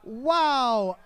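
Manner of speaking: loud, high-pitched disbelieving cry; shocked outburst. Real, not acted. Comic reacting to mother supplying a rude translation, shocked outburst.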